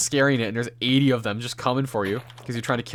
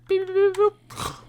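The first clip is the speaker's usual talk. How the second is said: slow high mock machine beeps; robotic beeps; slower than usual. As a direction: beep like a menu being clicked. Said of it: "she was like".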